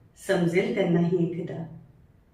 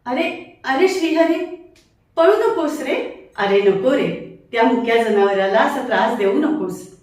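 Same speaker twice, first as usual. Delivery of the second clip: put-on higher and louder, shouting after a running boy; calling out, scolding; 5 semitones higher, 6 dB louder than usual. Elder shouts at boy teasing goat, loud scolding. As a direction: stop him before he hurts the animal.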